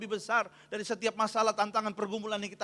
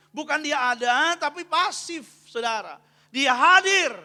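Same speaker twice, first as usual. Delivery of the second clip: loud, raised-pitch preaching; khotbah berapi-api; 4 semitones higher, 8 dB louder than usual. Real, not acted. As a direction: drive the point home to the congregation. Berkhotbah dengan penuh tenaga. (berkhotbah lantang dan tegas)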